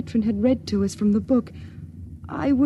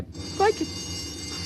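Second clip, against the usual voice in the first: frightened gasp; 9 semitones higher, 6 dB quieter than usual. Acted. Alarm bell, sudden fear.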